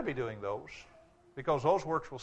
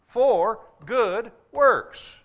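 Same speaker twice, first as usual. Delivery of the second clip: good works stretched very slow and louder; slow, loud stress; 6 semitones higher, 9 dB louder, much slower than usual. Acted. Preacher ends verse to congregation, slow and loud. Preacher hammers scripture point.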